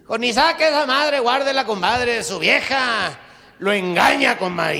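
loud, high, urgent pleading; loud urgent plea. Acted. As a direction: beg him to put the gun away. Compadre pleads to put away gun. (súplica urgente a gritos, aguda)

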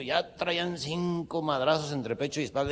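usual speaking voice

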